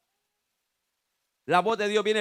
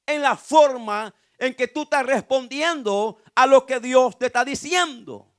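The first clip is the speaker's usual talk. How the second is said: louder, higher, driving the point home; fervent preaching; 4 semitones higher, 6 dB louder than usual. Acted. The preacher urges the congregation to answer God's voice, fervently. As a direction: preach it out to the whole church. Preacher builds to full force.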